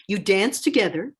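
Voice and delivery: plain reading voice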